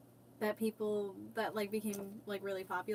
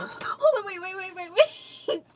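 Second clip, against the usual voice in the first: high, loud, gasping hold on; suddenly excited, stop everyone; 7 semitones higher, 6 dB louder than usual. Real, not acted. Suddenly finds the thing she sought.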